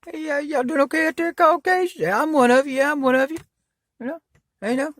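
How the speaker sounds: squeaky put-on voice, way up high; mock high greeting. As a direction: play a goofy overeager pal. Mock greeting to Caucasians, repeated.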